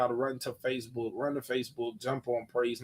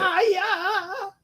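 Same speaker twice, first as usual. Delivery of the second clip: excited. Acted. Hyping the preacher bit.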